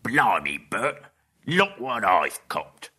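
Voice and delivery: loud, slow triumphant troll; excited troll. Acted. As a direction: show off the catch. William catches Bilbo.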